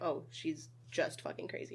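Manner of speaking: soft, unvoiced, slow scoff; mock whispered dismissal; dismissive mutter. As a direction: brush her off under your breath. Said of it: "aren't like".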